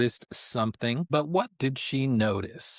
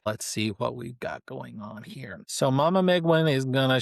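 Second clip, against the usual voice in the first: ordinary talk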